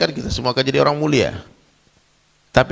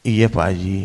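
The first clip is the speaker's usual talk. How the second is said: meek compliance; 3 semitones lower, slower than usual. Retold or imitated. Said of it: "dia bisa mendengar nasihat".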